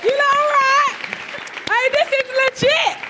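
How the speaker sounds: high, loud, thrilled greeting; excited squeal. Real, not acted. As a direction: squeal hello at your friends. Squealing greeting as guest arrives.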